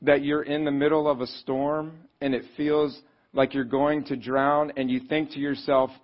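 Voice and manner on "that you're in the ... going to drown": usual speaking voice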